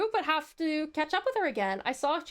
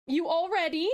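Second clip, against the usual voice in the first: ordinary talk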